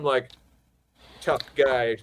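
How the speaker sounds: ordinary talk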